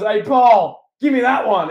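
loud, high call-out; Roman Reigns. Pretend. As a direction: holler an order across the ring. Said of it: "He goes".

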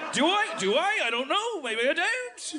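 high, laughing Aussie voice; playful Aussie uptalk; Australian accent. Shown as a demonstration. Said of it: "I'm trying".

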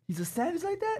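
high, disbelieving squeak; exaggerated surprise; surprised. Real, not acted. Mock surprised echo.

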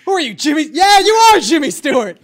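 loud, high accusing shout; shouted recognition. Acted. Passerby exposing Jimmy Stewart's disguise, loud mock accusation.

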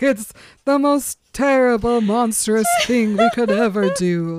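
high, loud, tortured vampire lament; mock-tragic Edward agonizing over feeding Bella blood; melodramatic Edward. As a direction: overplay the brooding vampire's anguish. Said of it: "Classic Edward".

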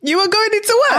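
high-pitched incredulous question; astonished question. Real, not acted. Co-host reacting to the guest's news, astonished. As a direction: ask it in high, stretched disbelief. Listener reacts to going into work.

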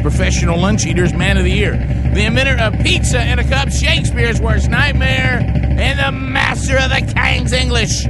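booming ring announcer build-up; grand entrance announcement. Acted. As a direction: build up like a boxing announcer, then hold it out. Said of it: "put your hands together for".

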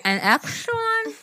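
high, slow Wisconsin imitation; mock Wisconsin drawn-out vowels; stretched-out Wisconsin. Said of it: "like it's stretched out".

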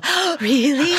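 high gasping "Oh, really?"; surprised mom. Retold or imitated. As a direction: mom caught off guard, voice jumping up. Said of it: "I felt like my mom go".

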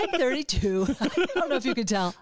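chuckling through a joke about age; laughing. Real, not acted. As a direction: laugh as you say it. Jokes about own age, laughing.

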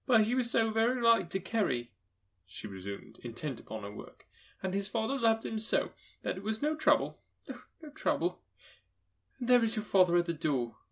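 a grieving mother keeping cheerful; mother's voice. Acted. Mother recalling Tiny Tim to her children, tender.

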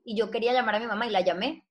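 usual speaking voice

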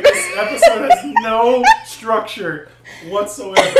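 high, slow laughing complaint. Real, not acted. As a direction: laugh it out helplessly. Chaotic laughing hot sauce challenge.

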